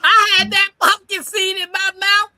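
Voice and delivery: excited telling. Real, not acted. Telling of seed flying out.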